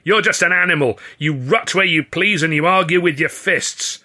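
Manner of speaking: high, loud angry insult; losing temper. A narrator's reading. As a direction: hurl it out, slowing for emphasis at the end. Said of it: "losing his temper completely".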